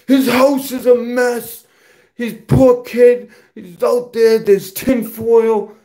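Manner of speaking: high, slow sneering parody of his rival; mock him in a silly high voice; mocking his video. Quoting a rival's video in a high mocking voice. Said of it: "he makes a whole video".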